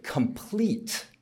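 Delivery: "complete" drawn out and stressed. Real, not acted. Quoting a health definition to the audience, stressing "complete". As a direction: underline the word "complete" with your voice. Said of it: "I underline that word complete".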